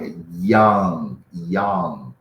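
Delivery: put-on teacher models "young" with an English y; correct young. Shown as a demonstration.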